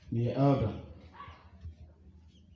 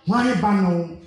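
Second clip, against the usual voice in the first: calling out; 6 semitones higher, 6 dB louder, slower than usual. Acted. Repeats the call louder.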